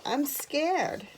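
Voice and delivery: high, small tearful confession; mildly acted frightened sob; sobbing, acted. A narrator's reading. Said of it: "Edith sobbed".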